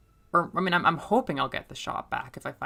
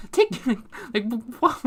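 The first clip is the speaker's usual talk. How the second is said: shocked dad; 9 semitones higher, 5 dB louder than usual. Retold or imitated. Father is taken aback.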